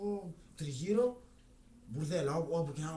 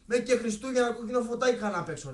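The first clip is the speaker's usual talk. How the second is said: loud, higher ranting explanation; worked-up storyteller ranting loud and higher; heated rant; 7 semitones higher, 10 dB louder than usual. Real, not acted. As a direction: ramp up and rant it out. Teller's heated rant continues.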